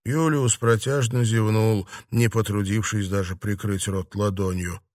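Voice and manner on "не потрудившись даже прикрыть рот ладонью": ordinary delivery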